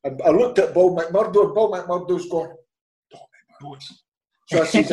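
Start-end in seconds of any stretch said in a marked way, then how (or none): 3.1-4.0 barely audible, voiceless prompt; hushed stage whisper; secretive whisper. Retold or imitated. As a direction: whisper the name like a covert tip-off. Agent secretly prompting the caller's name.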